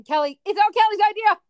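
high, loud squeal of blame; panicked sister. Retold or imitated. Sister ratting out her sibling to dad, high panicked squeal. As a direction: squeal it to save yourself. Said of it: "You were like".

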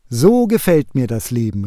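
ordinary delivery, nothing marked

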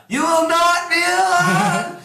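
high, loud, drawn-out mock yell. Game master voices the priestess yelling across the cavern, hammed-up shout. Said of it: "she yells out".

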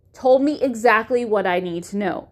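ordinary talk

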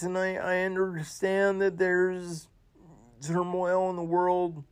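high, tight voice fighting back tears; choked up. Real, not acted. Host mourning Kevin Conroy, holding back tears. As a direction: let the voice crack as you push through. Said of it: "Sorry, I'm getting a little emotional".

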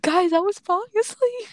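high-pitched, rising excuse; imitated defensive squeal of an excuse; retold pleading protest. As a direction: plead your case up high.

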